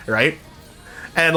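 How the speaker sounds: usual speaking voice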